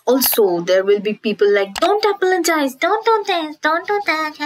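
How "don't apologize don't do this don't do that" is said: high-pitched mock nagging; hammed-up scolding, pitched way up; mocking advice-giver. Vlogger mimicking people who give advice as if it's easy, mocking.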